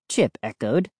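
usual speaking voice

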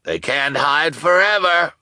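high, loud call; crying out. Acted. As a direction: shout it to Lou. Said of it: "the shopkeeper cried".

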